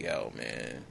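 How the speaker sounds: very low, quiet brush-off; unimpressed, sinking low and soft; unmoved sergeant. Retold or imitated. Sergeant refusing to budge at a soldier's life story, unmoved. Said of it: "he not really even budging".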